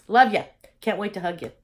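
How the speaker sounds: ordinary talk, nothing marked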